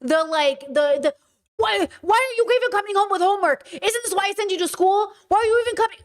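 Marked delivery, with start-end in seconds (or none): 1.5-6.0 high, mock furious dad; hammed-up angry father scolding; imitating dad yelling. Dad scolding kids over homework, hammed-up yell. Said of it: "The yelling".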